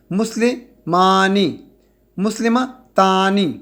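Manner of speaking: مسلمانی and مسلمہ تانی said slowly, syllable by syllable; slow demo. Shown as a demonstration. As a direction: stretch the endings so students hear آنی. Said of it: "آخری حرف پہ زبر لگا لیں اور آنی لگا لیں". (آہستہ اور واضح مثال)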